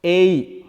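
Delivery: A as a loud gliding ay; diphthong A. Shown as a demonstration. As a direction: glide from e to i on A. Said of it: "this is pronounced".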